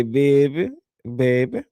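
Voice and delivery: put-on New Orleans accent. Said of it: "say baby with my New Orleans accent".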